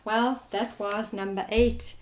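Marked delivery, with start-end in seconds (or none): none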